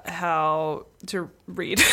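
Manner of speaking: normal talking voice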